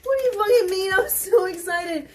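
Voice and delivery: loud mock outburst, pitch up; retold outburst. Retelling her lunch rejection, a loud mock outburst. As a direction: blurt it out in outrage. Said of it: "I was like".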